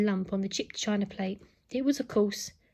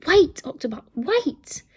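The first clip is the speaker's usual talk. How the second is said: raised, urgent call to wait; Nanny calling out; 5 semitones higher, 7 dB louder, slower than usual. A narrator's reading. Nanny calls to the impatient robot at the door, calling out. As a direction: call out sharply through the door. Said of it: "It was Nanny's voice".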